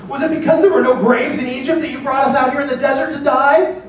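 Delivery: high, loud desperate complaint to Moses; frightened Israelites cry out in accusation; panicked outcry. Acted. Israelites crying out to Moses, high panicked complaint. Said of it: "they cried out to the Lord".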